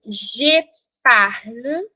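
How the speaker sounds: "Je parle" drawn out very slowly, every sound clear; slow French demo. Shown as a demonstration. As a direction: say "Je parle" slowly for the class to repeat. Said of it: "Repete comigo".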